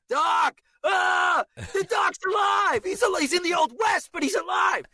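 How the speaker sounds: high, strained film-hero scream; drawn-out high cry of relief; high frantic yelling. Acted. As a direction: yell it out at the top of the range. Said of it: "yelling over and over and over".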